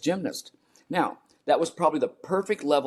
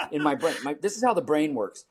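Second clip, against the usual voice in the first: usual speaking voice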